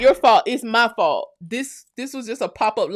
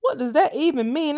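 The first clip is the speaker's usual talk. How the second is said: confused question; 5 semitones higher than usual. Retold or imitated. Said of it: "I'm like".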